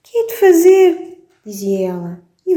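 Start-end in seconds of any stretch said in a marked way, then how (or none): 0.0-1.2 much higher, louder wailing mother; mother in despair, hammed-up crying voice; mock crying. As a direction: wail it like a tearful mum. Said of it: "A mamã começou a chorar".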